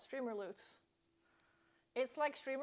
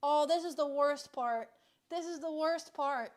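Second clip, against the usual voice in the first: loud frustrated groan; 9 dB louder than usual. Real, not acted. Frustrated waiting for mosquito.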